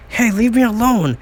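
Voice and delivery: high-pitched protest; acted plea to be left alone; protesting cry. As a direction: fend off bullies, pitched high. Bullied kid protests.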